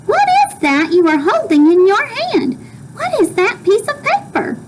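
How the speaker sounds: put-on high-pitched, curious boy character; inquisitive William Spiver. William Spiver asking Flora's mother about the paper, high and inquisitive.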